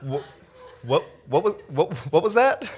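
acted freaked-out double take; mock alarm. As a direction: sputter in disbelief at what you just heard. Parent reacting to creepy confession.

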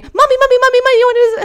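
put-on high, loud eager child calling mommy; imitating her son calling for mommy; excited little kid. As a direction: pipe up like a four-year-old tugging at mom. Son calling to his mom.

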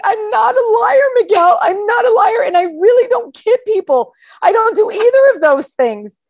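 loud, high-pitched mock wail; hammed-up outraged denial; indignant protest. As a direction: plead your innocence, voice way up. Acted indignant denial to Miguel.

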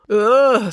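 loud, high "ugh" of revulsion; disgusted groan. Acted. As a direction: groan as if about to faint. Finn sickened by arm, faints.

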